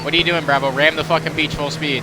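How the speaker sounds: shouting. Real, not acted. Ordering driver over engine.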